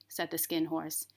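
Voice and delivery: usual speaking voice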